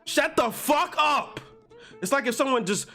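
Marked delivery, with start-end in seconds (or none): 0.0-1.4 slow, drawn-out furious yell; real shouted outburst; angry shout. Real, not acted. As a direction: bark it out, fed up. Angry rant at author.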